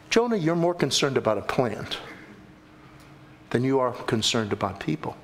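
lightly voiced God, low and measured; God reproving. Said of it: "And God says".

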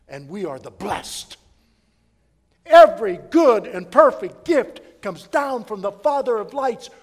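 loud, ringing pulpit voice; full-force preaching. Acted. Preacher thundering scripture to the church, loud and ringing. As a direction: preach it out big to the congregation.

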